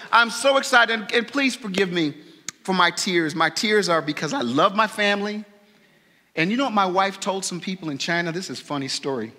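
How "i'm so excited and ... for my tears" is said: ordinary talk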